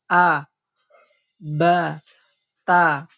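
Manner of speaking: a, ba, ta said light and thin; thin letters. Shown as a demonstration. Teacher reads thin letters to the class. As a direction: read the light letters with the lips open. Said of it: "Ti-, tipis".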